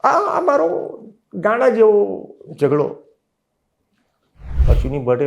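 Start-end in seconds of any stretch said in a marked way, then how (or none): 0.0-3.0 put-on high, pleading cry of a hurt boy; much higher upset child's complaint; tearful protest. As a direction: voice raised high as if crying again. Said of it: "હું પહેલી વખત રડ્યો".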